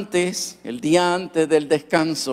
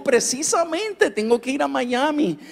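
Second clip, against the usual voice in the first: eager reply; 10 semitones higher than usual. Retold or imitated. Eager reply to his father.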